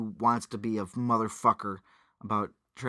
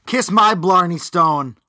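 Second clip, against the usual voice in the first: louder, slow, higher stage Irish; loud mock Irish line; 6 semitones higher, 11 dB louder, slower than usual. Joking about a better movie line, loud mock Irish. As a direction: belt it out like a cheesy Irish villain. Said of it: "say Irish things".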